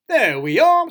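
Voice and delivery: high, loud, chirpy; cheerful presenting. A narrator's reading. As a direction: present it proudly and brightly to a guest. Cheerful cousin serving breakfast.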